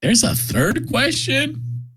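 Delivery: high, slow, disbelieving; mock surprise. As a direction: stretch it out in fake shock. Mock disbelief at more questions.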